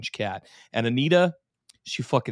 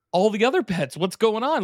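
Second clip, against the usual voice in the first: higher, worried quote; mock panicked wife; 8 semitones higher than usual. Host voicing the wife searching for pets, mock alarm.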